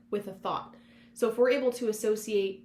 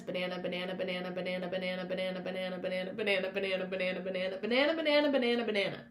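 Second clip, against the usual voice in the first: word repeated over and over; 3 semitones lower, much faster than usual. Shown as a demonstration. Showing how a word goes meaningless by saying banana over and over. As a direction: rattle off banana until it loses meaning. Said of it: "say banana fifty times".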